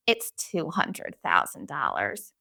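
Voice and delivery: low, slow, soft deadpan correction; imitating the principal. Imitating the principal correcting her, low and slow. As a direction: drone it out like a smug bureaucrat. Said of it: "And he goes".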